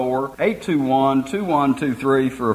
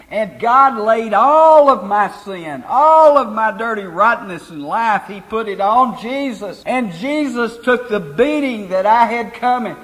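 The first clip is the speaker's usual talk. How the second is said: forceful sermon delivery with rising, swelling key phrases; preaching; 6 semitones higher, 3 dB louder than usual. Real, not acted. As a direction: preach it with conviction, driving the key words. Preaches to congregation after radio plug.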